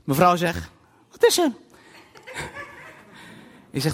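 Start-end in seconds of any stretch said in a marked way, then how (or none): 1.1-1.5 wife asking. Retold or imitated. Wife asks in the night.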